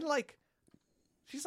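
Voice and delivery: ordinary talk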